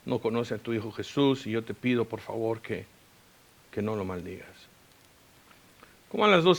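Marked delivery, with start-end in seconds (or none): 0.0-4.5 soft, low plea to God; hushed, humble petition; retold prayer. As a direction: intercede quietly, with pauses, dropping lower at the end. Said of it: "Y le dije, "Señor".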